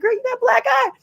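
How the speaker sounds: very high, incredulous; exasperated retort. Retold or imitated. Rapper retorting to a girl defending her abuser, exasperated. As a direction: point out the obvious.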